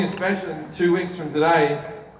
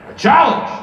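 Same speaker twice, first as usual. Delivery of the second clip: gravelly mock Pacino one-liner; high, loud movie-star quip; Pacino impression; 8 semitones higher, 6 dB louder than usual. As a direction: growl it like old Pacino.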